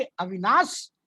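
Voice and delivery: "अविनाश" ending in s instead of sh; Bihari-accented "अविनाश", plain s ending; Bihari pronunciation. Shown as a demonstration. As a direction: say "अविनाश" Bihari-style with a final s. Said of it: "कहीं-कहीं बोलेंगे अविनाश".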